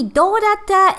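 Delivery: put-on light call to a child; father's greeting. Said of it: "or something like, maybe".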